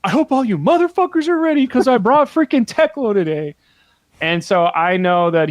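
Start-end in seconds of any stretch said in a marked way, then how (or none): 0.0-3.6 higher, cocky trash talk; hyped-up opponent. Retold or imitated. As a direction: taunt the table before the match. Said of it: "he goes".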